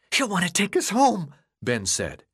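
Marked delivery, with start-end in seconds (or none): 0.1-1.3 high, panicked pleading; frantic. Acted. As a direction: sound desperate and unhinged. Ben raving about Thomas.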